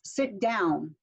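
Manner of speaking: "Sit down" with the pitch dropping on "down"; falling command intonation. Shown as a demonstration. Teacher modelling a command, falling intonation. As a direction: end "down" on a clear fall.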